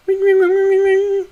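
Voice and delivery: very high sing-song phone ring; ringing phone tune done in a high, loud voice; imitated ringtone. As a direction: chirp the ringtone like a tiny phone. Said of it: "muffled tune plays".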